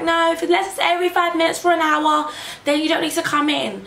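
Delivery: high, prim sing-song rule; mock hospital voice.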